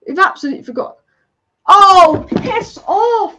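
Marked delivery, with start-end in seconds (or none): 1.7-3.4 loud, exasperated burst at the match; real angry fan yell; angry outburst. Real, not acted. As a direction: blurt it out loudly in disgust. Reacts to another goal going in.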